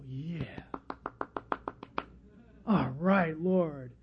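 low, soft tree voice; laid-back low murmur for a tree; talking trees. Acted. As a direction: voice a tree's hushed, low assent. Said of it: "And the trees go".

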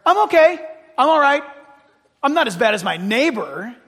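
loud, defensive self-reassurance; loud mock self-justification; self-justifying thought. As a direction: blurt it out loudly to excuse yourself. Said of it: "you might have a thought where".

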